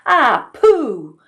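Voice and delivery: loud, high, sputtering sneeze; fish sneeze. Acted. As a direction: blast it out like a sneeze. Fish bitten on the nose.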